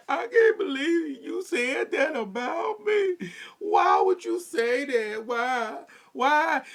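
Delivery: high, slow theatrical sob; performed crying. Retold or imitated. Mocking the sister performing tears on camera, high slow sob. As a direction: ham up the tears. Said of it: "started performing".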